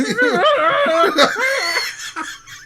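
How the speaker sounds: high, loud squealing laugh; shrieking laugh. Real, not acted. Cracking up at the Wookiee joke, shrieking laugh. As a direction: let the laugh shoot up into a squeal.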